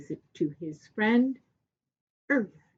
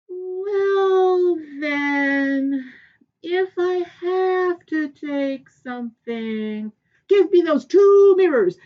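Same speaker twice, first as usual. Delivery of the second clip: wheedle, then demand loudly; greedy Earth King; 7 semitones higher, 9 dB louder than usual. Acted. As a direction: Earth King eagerly claiming the mirrors. Earth King greedily asks mirrors.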